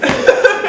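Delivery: brief bright burst of laughter; short laugh. Real, not acted. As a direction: laugh at your own excitement. Laugh after gushing.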